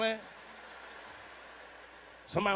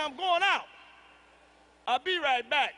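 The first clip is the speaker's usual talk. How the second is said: pitched up, louder, puffed-up announcement; bold declaration; 4 semitones higher, 4 dB louder than usual. Retold or imitated. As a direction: announce it like he's brave. Husband declaring exit boldly.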